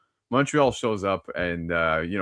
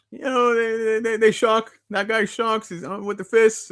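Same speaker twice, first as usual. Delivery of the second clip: teasing hint; 11 semitones higher than usual. Real, not acted. Hinting at spoiler playfully.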